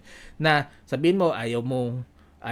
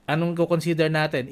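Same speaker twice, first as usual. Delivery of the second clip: usual speaking voice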